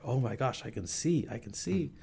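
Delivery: put-on a girl amazed she can suddenly see; breathless mock amazement; freaking out. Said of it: "she starts freaking out".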